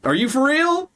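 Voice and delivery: high-pitched, playful disbelief; astonished challenge, pitched very high; incredulous. Real, not acted. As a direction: react in sheer disbelief, voice shooting up. Disbelief at hearing someone hates it.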